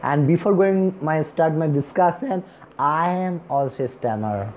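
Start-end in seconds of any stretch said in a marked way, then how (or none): none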